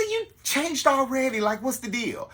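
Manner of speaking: can't believe he changed again; incredulous. Retold or imitated. Host finding his date changing clothes, incredulous. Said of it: "I said".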